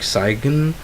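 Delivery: sigen said as two syllables with a hard G; mock mispronounced sign; wrong sign with hard G. Shown as a demonstration. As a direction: sound the G in sign like sig-en. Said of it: "you don't say sag-, sigen".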